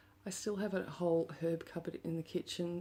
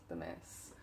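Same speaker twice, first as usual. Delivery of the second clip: much lower and softer, muttered; low dismayed mutter; 12 semitones lower, 9 dB quieter than usual. Real, not acted. Muttering in dismay at the mess in her room. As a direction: grumble it under your breath at the mess.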